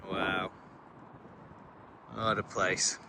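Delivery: normal talking voice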